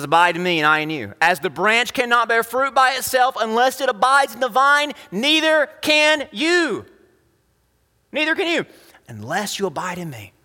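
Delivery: high, emphatic declamation building to a peak; preaching scripture. Acted. Preacher quoting Jesus on the vine, high emphatic declamation. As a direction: proclaim it, rising high toward the end.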